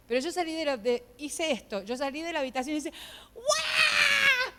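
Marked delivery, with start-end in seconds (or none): 3.3-4.5 high, loud comic bawl; loud wailing. Retold or imitated. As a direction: burst into an acted wail. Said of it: "Me puse a llorar a los gritos".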